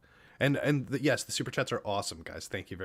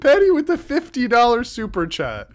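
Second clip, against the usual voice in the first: high, loud announcement of the gift; excited shout; 11 semitones higher, 10 dB louder than usual. Real, not acted. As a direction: cry it out in delight. Announcing big donation.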